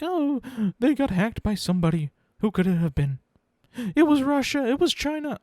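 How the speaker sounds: high-pitched mock speculation; mock conspiracy theorist. Podcaster imitating people guessing who hacked social media, mock alarm. Mimicking panicky online hack speculators.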